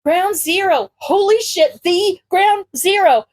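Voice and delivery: high, excited gushing; starstruck fan. A narrator's reading. Izuku thrilled at meeting his hero, excited.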